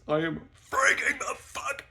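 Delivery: very high, loud, slow cry; panicked wail. Retold or imitated. Telling friend he's losing it, tearful-sounding panic. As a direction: wail it out at breaking point.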